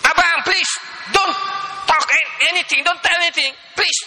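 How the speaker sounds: high-pitched mock telling-off in English; imitating scolding wife. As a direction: plead and scold, rising to a squeaky "stop it". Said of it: "Marah bini dia".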